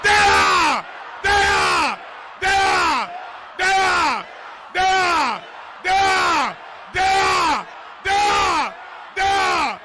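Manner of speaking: high, loud barked command, over and over; furious shout. Acted. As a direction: drive the demon out. Casting out the spirit.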